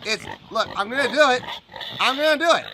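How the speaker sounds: loud, high mock-stubborn insistence; playful defiance. Insisting to viewers he'll replay the song, hammed-up defiant.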